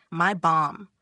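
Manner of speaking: ordinary delivery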